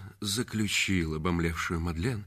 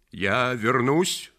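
slow, louder, raised warning; 4 semitones higher, 6 dB louder, much slower than usual. Acted. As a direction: draw it out as a promise to return. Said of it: "предупредил он". (предупреждающе, с нажимом)

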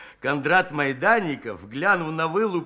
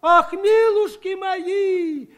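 much higher, slow, louder woman's cry; shocked gasp; 13 semitones higher, 5 dB louder, much slower than usual. Acted. Village woman gasps at the claws in the stew, high and shocked. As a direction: gasp it out in horror. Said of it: "ахнула одна из баб".

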